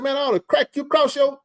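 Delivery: high, heated threat cut short; retold threat. Threatening the boy outside, heated mock threat. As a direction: threaten him, then break off laughing. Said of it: "I was hot".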